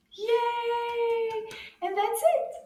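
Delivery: high, drawn-out celebratory yay; excited cheer. Real, not acted. Teacher celebrates the end of the rhyme, high excited cheer.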